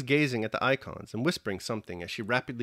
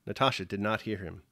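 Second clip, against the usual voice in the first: usual speaking voice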